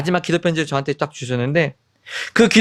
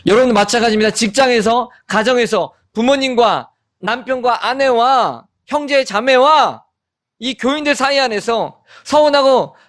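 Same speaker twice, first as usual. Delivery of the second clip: raised-pitch, insistent preaching; preaching exhortation; 6 semitones higher than usual. Real, not acted. Pastor exhorting congregation about family conflicts, insistent preaching. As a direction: press each phrase on the listeners in a high, rhythmic build.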